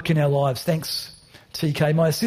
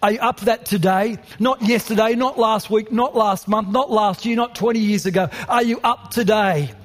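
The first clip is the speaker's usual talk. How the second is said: impassioned preaching; 5 semitones higher than usual. Acted. Rising passionate preaching climax.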